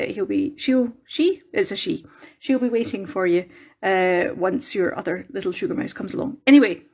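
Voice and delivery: ordinary talk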